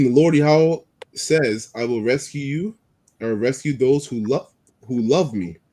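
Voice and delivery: slow, solemn Bible reading; scripture reading. Acted. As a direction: read it like scripture. Reads scripture aloud.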